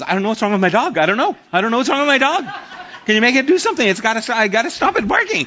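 high, frantic, rushed lady voice; an anxious lady fretting loudly about her dog; frantic dog owner. Retold or imitated. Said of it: "and she goes".

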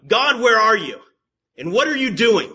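loud, high cry of protest; acted complaint to God. Believer at the weeping place questions God, loud complaint. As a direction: demand answers from heaven at full voice. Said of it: "and say".

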